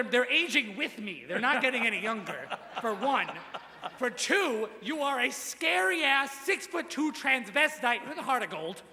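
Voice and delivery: ordinary delivery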